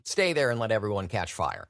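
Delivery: ordinary talk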